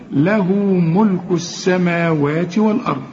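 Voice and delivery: له ملك السماوات والأرض recited melodically; tajweed recitation; Quran recitation. Shown as a demonstration. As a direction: recite the verse with a measured chant. Recites the verse.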